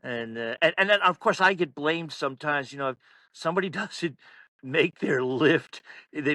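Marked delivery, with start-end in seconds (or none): none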